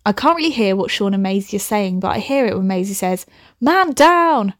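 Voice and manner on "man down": alarm call. A narrator's reading. Acted alarm call in story.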